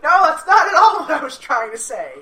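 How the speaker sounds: loud, high-pitched cry of denial; indignant protest. Retold or imitated. Student loudly protesting an official's accusation, indignant. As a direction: blurt out a loud, high objection.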